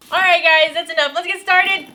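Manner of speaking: rally the viewers to start; cheerful call to get going; loud rallying call. Real, not acted. Calling out to start the tutorial.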